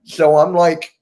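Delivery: ordinary talk, nothing marked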